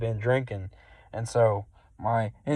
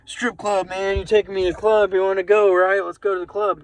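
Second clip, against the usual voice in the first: hyped passenger; 7 semitones higher than usual. Retold or imitated. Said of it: "He's like".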